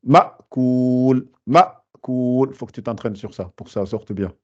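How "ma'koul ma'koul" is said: ma'koul with a short peaked hamza; slow demo of ma'koul with a crisp stop; sharp hamza. Shown as a demonstration. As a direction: make the hamza in ma'koul a quick spike. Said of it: "Elle dure pas longtemps et ça fait un pic".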